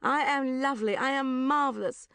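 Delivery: loud, high, hammed-up self-praise; mock affirmation. A narrator's reading. Woman vowing self-love, declaiming affirmations loudly and high. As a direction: proclaim it like a self-help mantra.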